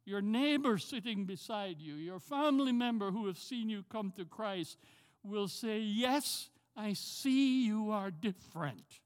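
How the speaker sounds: ordinary talk, nothing marked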